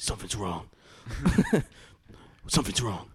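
brooding noir vigilante parody; Rorschach. Pretend. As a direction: mutter it like a masked detective sensing trouble.

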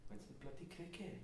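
soft Welsh lilt breaking into a laugh; Welsh accent. Acted. Moaning about the pricey cricket, soft Welsh accent. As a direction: moan softly in a Welsh accent. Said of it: "That's my Welsh voice".

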